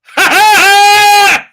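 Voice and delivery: high, loud, drawn-out manic cackle; imitated crazed dog noise. Dog taunting its owner before bolting, manic cackle. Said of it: "the dog looks at me like this".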